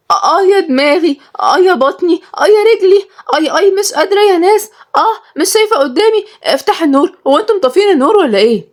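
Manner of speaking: very high, hammed-up wailing; feigned pain. Maryam faking illness to escape grandfather, high wailing. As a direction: moan and whine in a high pitch.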